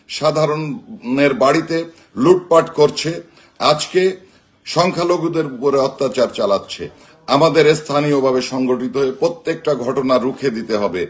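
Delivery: usual speaking voice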